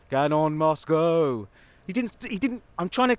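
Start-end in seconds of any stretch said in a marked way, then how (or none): 0.1-1.5 slow, drawn-out mysterious put-on voice; strange voice. Imitating the voice heard in the early hours, eerie. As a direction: intone it like a message from nowhere. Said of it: "while a strange voice said".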